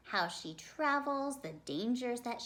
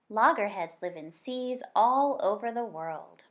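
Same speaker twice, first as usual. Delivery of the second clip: fuller, louder storybook reading voice; story narration; 2 semitones lower, 6 dB louder than usual. Acted. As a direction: read it aloud to the class like a picture book. Teacher reads picture book aloud.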